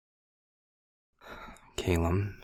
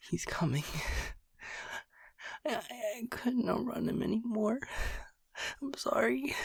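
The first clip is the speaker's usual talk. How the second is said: much higher, softer, rushed with fear; terrified gasping plea; 7 dB quieter, faster than usual. Acted. Caleb bursting in, hunted and panicking, in a high frightened rush. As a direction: blurt it out, barely holding together.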